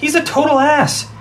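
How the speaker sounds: high-pitched, exasperated complaint; indignant outburst. Acted. Boy explains his dislike of the man, indignant. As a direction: vent your dislike in a heated burst. Angry about the man suggested.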